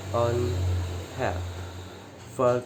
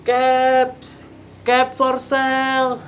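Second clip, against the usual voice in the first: much higher, louder street cry, last one slow; calling out; 12 semitones higher, 7 dB louder, slower than usual. A narrator's reading. Peddler walking back to town, calling out his caps. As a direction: hawk your wares down the street. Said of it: "and calling".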